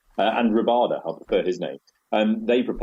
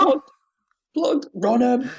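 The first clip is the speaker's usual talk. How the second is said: high urgent yell to the batsmen; mock yell; slower than usual. Fielder's mate shouting at batsmen to run, high yell. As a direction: bellow for them to run. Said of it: "the things you shout".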